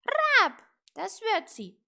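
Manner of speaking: very loud, high caw of agreement; croaking raven. A narrator's reading. Raven agreeing with little witch, croaking. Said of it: "krächzte Apraxas".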